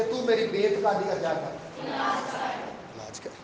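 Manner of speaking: soft, slow, hushed plea; pleading. Acted. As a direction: beg quietly for help. Father's plea, quoted by preacher. (دھیمی آہستہ التجا)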